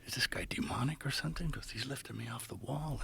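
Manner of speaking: hushed, whispered worry; worried whisper. Retold or imitated. Asking the priest about the healer, whispered worry. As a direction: confide it under your breath.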